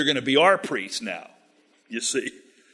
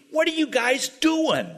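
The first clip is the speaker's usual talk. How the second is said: mock confrontation; 11 semitones higher than usual. Danites confront the priest, paraphrased.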